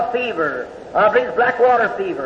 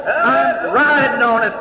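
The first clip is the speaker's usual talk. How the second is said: preaching; 5 semitones higher than usual. Acted. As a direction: hammer each word of the verse. Preaching quotes scripture again.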